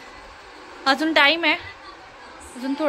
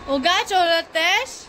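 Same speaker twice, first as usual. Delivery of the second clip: slow, high mock scolding; 4 semitones higher, 4 dB louder, much slower than usual. Real, not acted. Mother scolds baby playfully.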